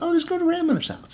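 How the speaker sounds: high, breezy mock parent voice, brushing off danger; mock carefree parent. The original tale's parents sending her off, in a high mock-carefree voice. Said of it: "The ones in the original said".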